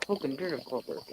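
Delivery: muttered low through teeth; muttered under breath. Real, not acted. Repeats it under her breath.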